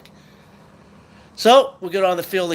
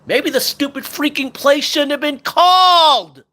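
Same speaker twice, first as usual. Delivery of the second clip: much higher and louder, slow exasperated outburst; furious; 11 semitones higher, 8 dB louder, slower than usual. Real, not acted. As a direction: explode at the stupid play call. Fan furious at play call.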